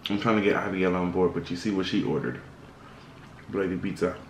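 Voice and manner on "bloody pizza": ordinary talk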